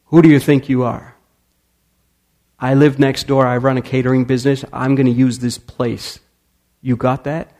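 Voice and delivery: loud, bullying threat; thuggish threat. Retold or imitated. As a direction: lean in and intimidate him. Thug threatens old neighbor.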